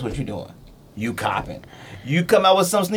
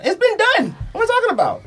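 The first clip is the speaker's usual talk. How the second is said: high, incredulous protest; exasperated outburst. Real, not acted. Arguing the shoe with friends, high exasperated. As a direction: throw up your hands at the idea. Heated sneaker argument.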